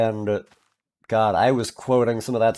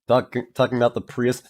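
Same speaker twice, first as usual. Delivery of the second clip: normal talking voice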